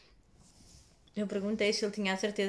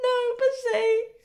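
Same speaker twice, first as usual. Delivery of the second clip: much higher, louder and slow through tears; crying with joy; 9 dB louder, slower than usual. Retold or imitated. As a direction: sob out the good news. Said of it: "comecei a chorar".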